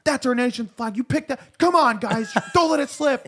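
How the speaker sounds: high, loud insistent shouts; rally them like an outraged veteran; hammed-up scolding of the flag crew; mock patriotic yelling.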